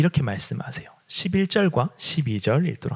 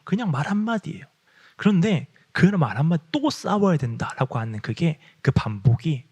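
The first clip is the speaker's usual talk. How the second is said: ordinary talk, nothing marked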